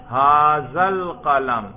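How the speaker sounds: loud, drawn-out example phrase; slow, projected classroom recitation; slow emphatic modelling. Shown as a demonstration. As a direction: stretch the words out firmly for the class. Teacher shows the contrasting phrase.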